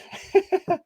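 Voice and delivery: high-pitched giggle; high laugh. Real, not acted. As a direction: burst of laughter. Laughing at own mistake.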